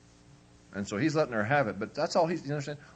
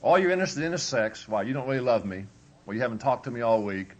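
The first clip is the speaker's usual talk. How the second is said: wife complaining; 4 semitones higher, 7 dB louder than usual. Retold or imitated. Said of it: "she'll say".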